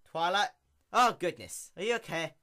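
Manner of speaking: loud, high startled concern; worried surprise. Acted. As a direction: gasp with worry. Applejack worried for her friend.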